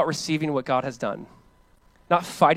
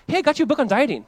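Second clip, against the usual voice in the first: higher, bright cheery giver; mock gift giver; 8 semitones higher, faster than usual. Giver offering an insulting present, chirpy and high. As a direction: hand it over all breezy.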